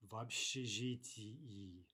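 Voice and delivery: "общежитии" slowly, with both final и syllables clear; teacher's slow model of "В общежитии"; very distinct. Shown as a demonstration. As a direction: spell out the ending of "общежитии" syllable by syllable. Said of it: "two syllables if we're speaking very distinctly".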